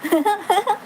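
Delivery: short, high giggle; real laugh. Real, not acted. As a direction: giggle in quick little bursts. Amused during live chat. (छोटी, ऊँची खिलखिलाहट)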